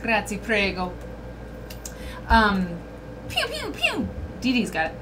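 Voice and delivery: normal talking voice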